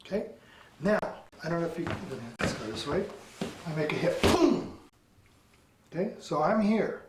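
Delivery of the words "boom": high boom, falling sharply; explosive vocal strike; imitated impact. Shown as a demonstration. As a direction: burst out with the hit noise. Imitates the demonstrated hit.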